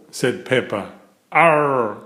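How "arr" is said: long drawn pirate "arr". A narrator's reading. George playing pirates on the ship, pirate voice. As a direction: growl it like a little boy playing pirates. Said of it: "in his best pirate voice".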